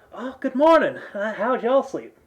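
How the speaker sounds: cheerful hostess calling good morning; high friendly tavern keeper hello; Maggie's greeting. Acted. GM voices Maggie greeting party.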